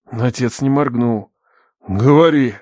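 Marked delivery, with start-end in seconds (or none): 1.9-2.6 loud, firm order; stern command. A narrator's reading. Father orders the captive to speak, stern.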